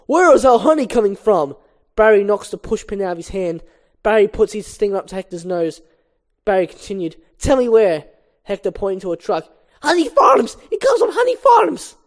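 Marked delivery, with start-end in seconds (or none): 0.1-1.6 loud, raised-pitch interrogation; fierce demand. Acted. Barry corners Hector over the honey, fiercely demanding. 7.4-8.1 loud, higher, threatening order; forceful demand. Acted. Barry with stinger at Hector's nose, forceful demand. 9.8-12.0 loud squeal, pitch leaping very high; panicked confession. Acted. Hector confesses to Barry, panicked. Cornered suspect gives in.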